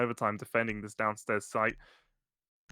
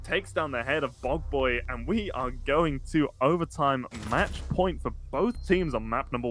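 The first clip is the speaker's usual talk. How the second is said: fast, high, excited play-by-play; esports caster; 3 semitones higher than usual. Acted. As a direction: call the clutch like a live caster. Caster calls a clutch round.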